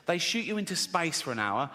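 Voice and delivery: normal talking voice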